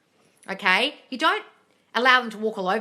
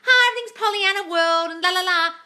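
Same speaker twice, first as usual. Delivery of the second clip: high, louder, sing-song mock cheer; mock Pollyanna; 11 semitones higher, 8 dB louder than usual. Coach mocks sugary positive-thinking teachers, sing-song. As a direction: lampoon naive positivity. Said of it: "I am not talking from, like".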